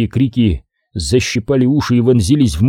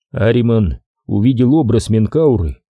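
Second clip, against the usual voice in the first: plain reading voice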